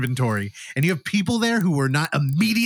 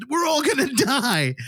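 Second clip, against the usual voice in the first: high, laughing mock panic; panicked cry; 10 semitones higher than usual. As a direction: cry out in hammed-up terror. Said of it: "our lead jumps up and goes".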